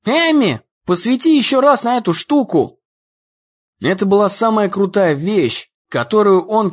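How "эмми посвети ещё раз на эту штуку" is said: high-pitched excited call; narrated cry, pitched high without full yelling; urgent cry. A narrator's reading. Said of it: "душераздирающий крик Дэна".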